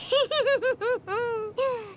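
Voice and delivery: bright delighted giggle after praise; happy laugh. Real, not acted. Pleased laugh after compliment.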